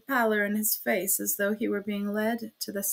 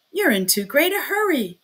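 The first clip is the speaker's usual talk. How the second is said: louder and higher, scolding; almost angry shout; 5 semitones higher, 6 dB louder than usual. A narrator's reading. Magistrate scolding the confessing prisoner, almost angry shout. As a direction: snap at him. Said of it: "he shouted at him, almost angrily".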